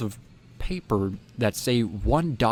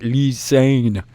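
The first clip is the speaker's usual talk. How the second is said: loud, higher, stretched-out call; imitated chorus reply; 4 semitones higher, 6 dB louder than usual. Four boys finally answering the man, loud repeat. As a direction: repeat it back loud and drawn out.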